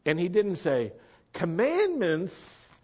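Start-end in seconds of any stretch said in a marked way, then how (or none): 1.3-2.7 high, drawn-out incredulous objection; mock indignation. Preacher voicing what Yeshua didn't say, mock indignation. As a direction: play a scandalized objector, stretching the words out.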